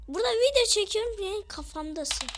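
high, loud, fast complaint; rapid-fire outraged protest; indignant yell. Real, not acted. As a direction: burst out in exasperation. Indignant at bird on his head.

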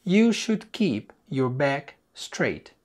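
slow, careful teacher read of the sentence; slow model sentence. Shown as a demonstration. Teacher reading slowly to the student. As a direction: spell it out slowly for the learner.

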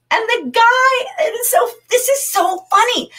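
high-pitched laugh breaking through the words; cracking up at the funny part; laughing excitedly. Real, not acted. Teacher cracking up telling the class about the grocer.

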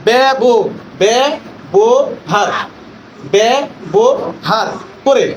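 ব্যবহার broken slowly into ব্যা, ব, হার; syllable by syllable. Shown as a demonstration. Trainer demonstrates syllables to teachers, ব্যবহার slowly broken apart. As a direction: stretch ব্যবহার করে with a gap after each syllable. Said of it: "এক প্রয়াসে যতটুকু উচ্চারণ করা যায়".

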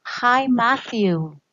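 "Hi, Matthew" said slowly, each word clear for the class; slow model for repeating. Shown as a demonstration. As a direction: model it slowly so kids can copy. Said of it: "Repeat after me". ("Hi, Matthew" lento y claro para repetir)

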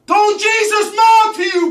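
high, loud shouted repeat; shouting. Acted. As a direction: yell the question.

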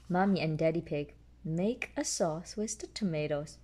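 ordinary delivery

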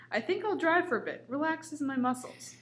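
high, bouncy cookie voice; high character voice. A narrator's reading. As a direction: play the cookie high and light.

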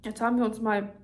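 chewing while talking; talking between bites; mouth full. Real, not acted. Seen 2: Bite at -9, jaw and lip chewing motions continue while talking.

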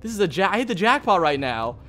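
ordinary delivery

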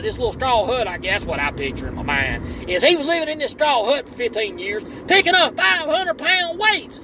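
put-on a fired-up Southern good ol' boy hollering at his hero. Biggest fan scolding Scooter for skipping the live feed, hollering. Character rant resumes.

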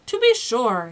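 self-assured and emphatic; proud. A narrator's reading. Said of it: "he replied proudly".

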